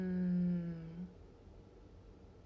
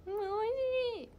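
short, high impression of a friend; ゆうちゃん; 14 semitones higher, much faster than usual. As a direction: do a little impression of your friend. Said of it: "ゆうちゃんの真似". (友達のちょっとしたモノマネ)

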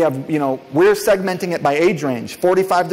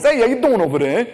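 higher-pitched mock Italian accent; 7 semitones higher, 4 dB louder than usual. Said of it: "do it in your Italian voice".

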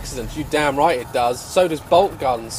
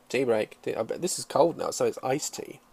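ordinary delivery

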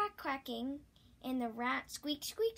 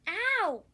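sharp high yelp of pain; loud cry of pain; 8 semitones higher, 9 dB louder than usual. Acted. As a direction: yelp as if just stung. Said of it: "cried the fat cat".

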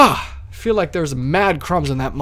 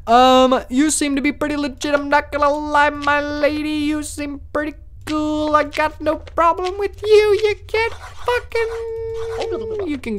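a much higher, sing-song guard voice; high put-on voice; 10 semitones higher than usual. Guard approving a lady in a high, fake-sweet voice. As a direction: lift the voice way up, sweet and fake-polite.

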